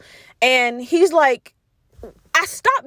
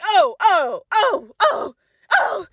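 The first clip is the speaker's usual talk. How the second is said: panicked oh; 6 semitones higher, much slower than usual. Retold or imitated. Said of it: "So I'm like".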